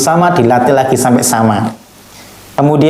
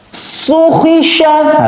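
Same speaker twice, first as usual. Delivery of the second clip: high, crisp Quran letters; fast, firm recitation; 13 semitones higher, much slower than usual. Shown as a demonstration. Teacher shows pupils fast, firm letter reading, high and crisp. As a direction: snap out each syllable firmly. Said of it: "Harus gitu".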